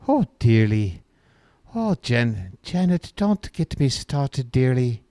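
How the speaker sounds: put-on Doubtfire-style British accent. Host teasing Janet about her guess, in put-on British accent. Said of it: "do an accent like that".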